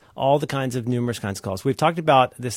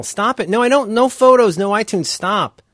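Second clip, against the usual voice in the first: high, loud exasperated protest; frustrated yelling; 9 semitones higher, 6 dB louder than usual. Real, not acted. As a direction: snap at the computer. Yelling at computer.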